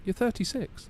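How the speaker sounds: high insistent imitation of his wife; lightly hammed-up wife insisting. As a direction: pitch it up as the wife correcting him. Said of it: "She's like".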